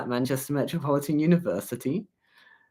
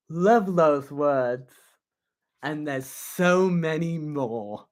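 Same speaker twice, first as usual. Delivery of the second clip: louder and higher, each word set apart like a proclamation; mock-grand poem reading; 4 semitones higher, 4 dB louder, slower than usual. Streamer reading a spoof Trump poem to viewers, in a pompous, punchy voice. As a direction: declaim the tweet-words one by one with swagger.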